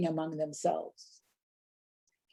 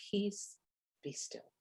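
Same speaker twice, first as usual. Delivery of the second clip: quiet calm command; 2 semitones higher, 13 dB quieter, slower than usual. Shown as a demonstration. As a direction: say Peace, be still softly, dropping away to almost nothing. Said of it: "or if I'm going to say it, do I say".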